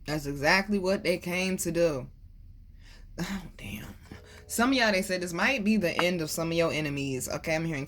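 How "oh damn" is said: hushed surprised whisper. Real, not acted. Whispering in surprise at what the cards show. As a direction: breathe it out under your breath. Reacting to a card.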